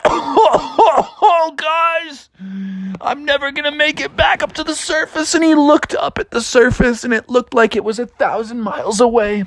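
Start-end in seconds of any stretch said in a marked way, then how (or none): 0.1-5.3 high, panicky little wail; running out of air. Acted. Bear pinned underwater despairs of surfacing, high gasping panic. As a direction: gasp it out high, as if drowning. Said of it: "he was running out of air".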